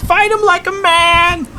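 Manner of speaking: high-pitched heckling shout; [name]. Acted. Merchant jeering the fallen fighter, shrieking. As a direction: screech it from the crowd in a put-on voice. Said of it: "is [name]".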